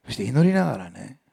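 usual speaking voice

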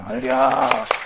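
a high, slow, ringing hallelujah; joyful, stretched-out praise cry; drawn-out hallelujah. Real, not acted. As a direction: lift it up in praise to God. Praising God after reading.

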